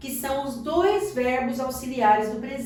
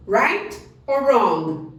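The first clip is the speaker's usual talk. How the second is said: slow quiz question; 2 semitones lower, much slower than usual. Shown as a demonstration. Teacher quizzes students.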